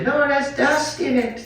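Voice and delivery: high-pitched, laughing imitation of a fussy mom; mock mom voice. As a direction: ham up the mother's warning through a laugh. Mom scolding kid in story.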